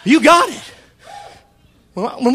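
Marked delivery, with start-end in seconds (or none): 0.0-0.7 loud, high revival shout with stretched words; full-force preaching. Real, not acted. Preacher praising God before the church, exultant shout. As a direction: proclaim it in triumph, each phrase hurled out.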